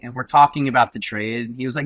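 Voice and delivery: ordinary delivery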